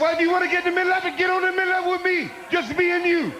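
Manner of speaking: fast, high-pitched angry comeback; heated challenge. Acted. Wrestler dares rival to get in the middle, heated challenge. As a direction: rush at him, daring him to fight.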